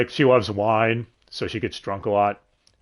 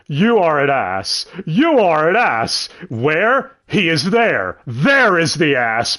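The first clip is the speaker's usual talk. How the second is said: put-on slow, loud, higher-pitched drunken declaration; tipsy Holo; 5 semitones higher, 6 dB louder, much slower than usual. Drunk Holo bantering with Lawrence, loud mock accusation. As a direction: hammer out each word like an indignant drunk. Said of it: "a friendly drunk".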